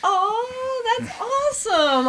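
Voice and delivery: high, drawn-out squeal of delight; delighted gushing. Real, not acted. Host hearing guest loves the Barbie movie, delighted gushing. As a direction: gush with real excitement, very high.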